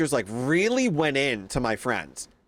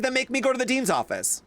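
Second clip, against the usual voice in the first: defiant dare; 10 semitones higher than usual. Retold or imitated. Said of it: "I said".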